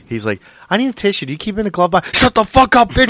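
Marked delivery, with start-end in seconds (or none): 0.7-2.2 put-on high, innocent girly voice; lightly mocking sweet young woman. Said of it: "an impression of [name]". 2.2-3.1 angry shout. Retold or imitated. [name] voiced in retold bit.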